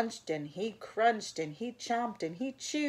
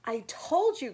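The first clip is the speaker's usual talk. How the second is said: put-on loud, higher child's cry; 7 semitones higher, 7 dB louder than usual. A narrator's reading. Eddie proves to Mom the bear is real, crying out. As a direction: cry it out insistently. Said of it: "cried Eddie".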